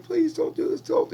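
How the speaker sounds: high, loud begging; desperate pleading. Retold or imitated. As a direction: plead for your life in panic. Captive begs for his life.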